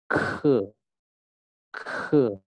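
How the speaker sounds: "Ke" with a strong puff of air after k; aspirated k. Shown as a demonstration. Teacher shows K has air in it, aspirated "Ke". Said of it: "Ada udaranya ya".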